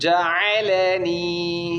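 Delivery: جعلنی read very slowly, each sound drawn out; teacher models جعلنی slowly for the pupil. Shown as a demonstration. Teacher modelling جعلنی slowly. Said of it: "زور سے پڑھیں".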